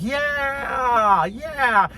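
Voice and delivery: high whooping yeah, yeah like a bar crowd; a hammed-up crowd whoop; mock crowd cheer. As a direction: cheer it like drunk onlookers.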